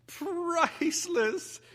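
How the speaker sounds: a very high, slow, laughing cry of delight. A narrator's reading. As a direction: squeal it out through laughter. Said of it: "Miss Chestnut laughed".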